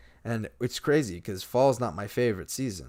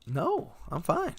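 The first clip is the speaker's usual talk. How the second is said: put-on higher, slow young girl voice; Ellie; 11 semitones higher, slower than usual. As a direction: give Ellie's reassurance high and slow. Ellie answers Joel.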